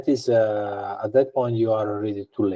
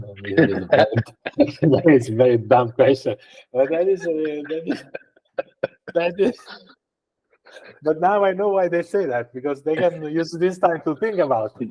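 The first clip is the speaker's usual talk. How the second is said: high-pitched, talking through laughter; laughing; 6 semitones higher than usual. Real, not acted. Guest laughing at podcast stalling tricks, amused and laughing. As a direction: crack up while getting the words out. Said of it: "I'm laughing because".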